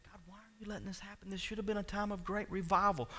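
imitating a quiet, troubled plea; hushed questioning prayer. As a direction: question God under your breath. Imagined troubled prayer to God.

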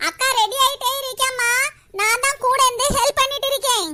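ordinary talk

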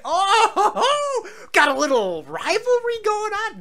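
high, loud laughing squeal; delighted giggly gloat. Real, not acted. Laughing at villagers' rivalry.

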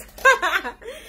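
high, bright burst of laughter; a short happy laugh; delighted laugh. Real, not acted. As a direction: laugh at the husband's teasing. Laughing at husband's teasing.

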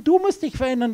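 ordinary talk

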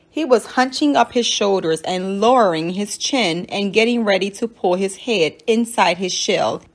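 plain reading voice

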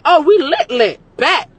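hyped, loud, bursting with glee; excited "we lit". Retold or imitated. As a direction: cheer it like you've hit the jackpot. Excited reaction to rich date.

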